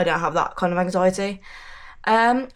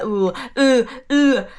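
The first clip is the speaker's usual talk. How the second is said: scared oohs; 3 semitones higher, 4 dB louder than usual. Retold or imitated. Scared of shattering glass.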